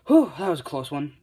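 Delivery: hammed-up relieved outburst; acted relief. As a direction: exclaim after a narrow escape. Dad relieved after wiping head.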